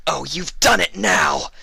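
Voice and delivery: high, loud, slow furious screech. A narrator's reading. Vinyl just shot in the leg, furious screech. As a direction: scream it in pain and rage. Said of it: "Vinyl screeched".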